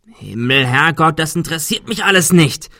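a loud exasperated outburst; shouting. Acted. Adrian shouts at the others, loud and exasperated. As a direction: yell it out, fed up with the talk. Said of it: "schrie Adrian".

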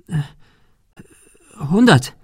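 hesitate, then blurt it out; stammering. A narrator's reading. Girl at knifepoint offers money, stammering. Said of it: "stammelte Sarah".

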